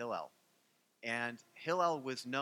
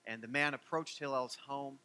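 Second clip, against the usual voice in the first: ordinary talk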